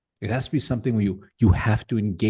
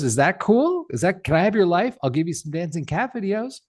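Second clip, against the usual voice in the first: higher, lightly mocking tech boss pitch; mock Zuckerberg; 6 semitones higher than usual. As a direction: play a breezy billionaire asking for your life, tongue in cheek. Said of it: "Mark Zuckerberg were to call you up and say".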